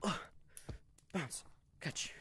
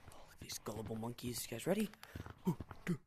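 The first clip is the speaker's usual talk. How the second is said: ordinary delivery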